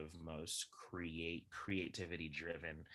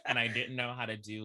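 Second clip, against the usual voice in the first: amused, higher and louder through laughter; laughing; 5 semitones higher, 12 dB louder than usual. Real, not acted. Former teen Daddy Warbucks admitting inexperience, laughing. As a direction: laugh while confessing. Laughing at own teenage casting.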